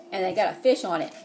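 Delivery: usual speaking voice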